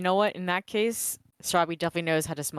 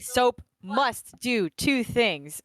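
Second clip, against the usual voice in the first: slow, higher-pitched proclamation; emphatic declaration; 5 semitones higher, much slower than usual. Acted. Player rules on what soap must do, slowly and emphatically. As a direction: lay down the rules, word by word.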